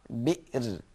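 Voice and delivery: Bi'r said softly, cut short on the Ra; stopping form. Shown as a demonstration. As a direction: end quietly on Bi'r. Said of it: "if you want to stop".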